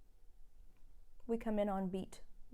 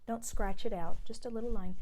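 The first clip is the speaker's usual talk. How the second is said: ordinary delivery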